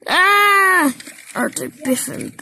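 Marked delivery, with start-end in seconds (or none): 0.1-0.9 startled cry. A narrator's reading. Crash in the story.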